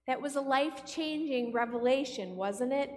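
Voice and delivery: ordinary talk, nothing marked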